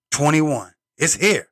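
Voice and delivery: ordinary talk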